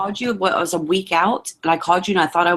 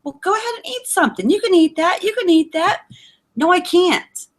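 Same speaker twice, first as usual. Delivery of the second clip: high, sing-song coaxing voice; mock pushy hostess; 10 semitones higher than usual. Friends pushing food on her, high sing-song coaxing. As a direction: coax her to eat, sweetly pushy. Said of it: "they make a dish".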